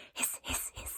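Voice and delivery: short, sharp whispered hisses; cat hissing. A narrator's reading. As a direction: hiss like a mocking cat. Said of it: "started hissing in short, sharp bursts".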